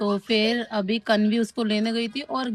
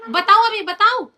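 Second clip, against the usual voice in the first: mother scolding her daughter for pushing her brother; 10 semitones higher than usual. Real, not acted. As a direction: tell her off firmly.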